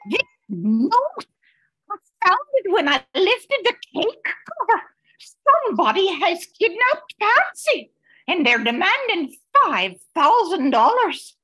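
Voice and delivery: high, frightened rush of words; terrified mother with the ransom note; alarmed. Acted. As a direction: panic breaking through as she reads. Kidnapping note found, distraught mother.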